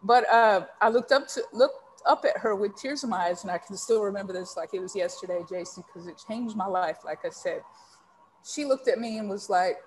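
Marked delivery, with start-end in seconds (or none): none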